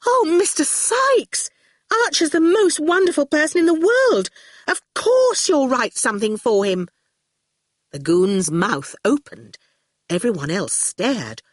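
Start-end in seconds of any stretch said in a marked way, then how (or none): 0.1-6.9 high-pitched, fervent plea; gushing adoration. A narrator's reading. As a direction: plead like a lovestruck girl. Fifi gushes about Archer.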